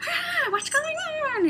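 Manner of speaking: drawn-out high squawk; comic falsetto finch; imitated husband finch. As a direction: squeal it like a startled little bird. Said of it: "he comes over and goes".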